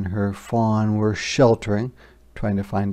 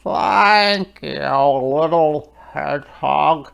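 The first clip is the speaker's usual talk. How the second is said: slow, louder puffing otter; 3 semitones higher, 4 dB louder, slower than usual. Acted. Otter greets Hedgehog, slow and puffing. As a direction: huff it out like a cold otter. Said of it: "said Otter".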